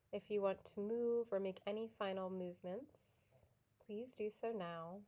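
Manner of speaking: soft, unhurried yoga nidra guidance with long pauses; guided meditation. Real, not acted.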